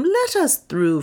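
asking the crowd to part; Leonard asking. A narrator's reading. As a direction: Leonard first asking the throng to let them through. Leonard repeats request.

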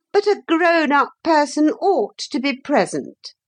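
high-pitched officious lecture; hateful, self-righteous lady. A narrator's reading. Motor lady demanding to supervise the children, high and officious. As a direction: insist on your rights in a high voice.

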